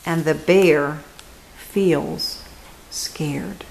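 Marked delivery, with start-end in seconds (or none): 1.6-3.6 hushed refrain. A narrator's reading.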